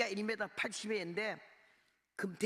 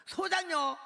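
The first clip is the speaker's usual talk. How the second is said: Korean guard's incredulous cry, much higher and louder; shocked guard; 11 semitones higher, 7 dB louder, slower than usual. Retold or imitated. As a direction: blurt it in disbelief at the country woman.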